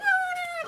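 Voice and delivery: very high drawn-out whistle noise; imitated long whistle. Showing co-host how the captain summons her, imitated whistle. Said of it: "I'm gonna do this".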